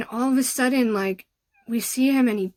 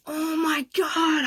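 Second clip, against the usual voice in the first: higher, slow, drawn-out amazement; stunned oh my God; 5 semitones higher, much slower than usual. Retold or imitated. Friends hearing the prophecy about their beach, stunned. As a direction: let it hang in disbelief. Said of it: "we were like".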